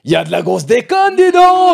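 cri moqueur qui monte très haut; exclamation ironique. Pretend. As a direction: explose d'enthousiasme feint, de plus en plus fort. Explose, ironique, après lecture austère. (fausse euphorie criée)

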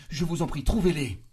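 loud, high anguished cry; rising to a pleading shout; frantic plea. A narrator's reading. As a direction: beg at full desperation. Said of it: "Elle s'exaltait".

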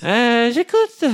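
singsong operator greeting climbing high. Acted. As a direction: sing out the hotline greeting, ending high.

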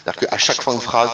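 usual speaking voice

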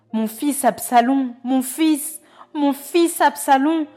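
loud, high, slow anguished wail; grieving lament. Acted. The king mourning his son Absalom, loud slow lament. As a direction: cry out for the lost son, slowing with grief. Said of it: "saisi d'émotion, monta dans la chambre au-dessus de la porte et pleura".

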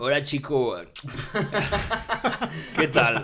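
loud Spanish gangster greeting; Spanish accent. Acted. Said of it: "Bonne introduction".

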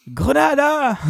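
high shouted game callout; grenade callout. Pretend. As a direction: yell it like throwing a grenade.

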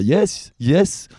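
put-on high, slow French-accented English; French speaker's English. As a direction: play a Frenchman eagerly understanding English. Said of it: "le français, il dira". (imitation d'un Français parlant anglais)

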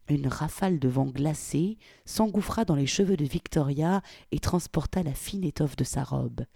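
ordinary delivery, nothing marked

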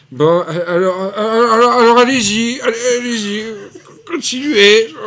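crier plus aigu et plus fort, à bout de nerfs; Galabru excédé. Retold or imitated. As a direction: imiter Galabru qui perd patience. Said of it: "Galabru, excédé, pète un câble et fait". (Galabru excédé)

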